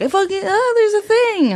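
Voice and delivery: hammed-up child seeing a thing; excited kid. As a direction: squeal it like a kid who spotted something. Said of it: "all these kids being like".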